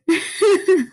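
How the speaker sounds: short high laugh, falling; a quick naughty chuckle; giggle. Real, not acted. Podcaster laughing off her Damon Salvatore joke, high giggle.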